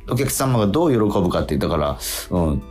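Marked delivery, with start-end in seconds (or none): none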